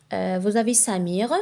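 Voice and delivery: ordinary talk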